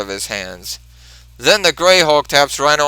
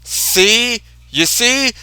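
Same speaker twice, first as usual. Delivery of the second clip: high pleading outburst; 9 semitones higher, slower than usual. Retold or imitated. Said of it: "and says".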